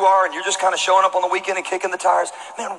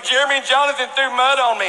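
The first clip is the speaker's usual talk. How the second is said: put-on tattling kid voice; 8 semitones higher than usual. Sammy tattling to his dad.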